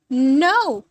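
a loud, sudden protest of no; sharp cry of no. Acted. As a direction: blurt out no in alarm. Mom refuses cops threat.

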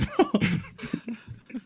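high-pitched burst of laughter. Real, not acted. Big laugh at own joke.

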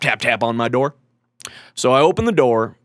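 normal talking voice

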